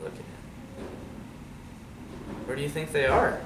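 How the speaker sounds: plain reading voice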